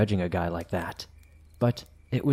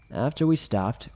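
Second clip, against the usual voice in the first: usual speaking voice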